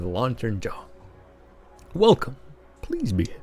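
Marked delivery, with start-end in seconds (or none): 1.9-3.3 a first rough pass at the bouncer; tentative doorman greeting; first try troll bouncer voice. Shown as a demonstration. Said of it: "What kind of voice should you give him?".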